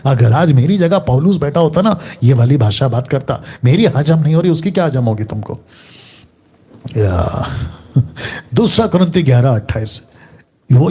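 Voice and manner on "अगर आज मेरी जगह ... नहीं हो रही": normal talking voice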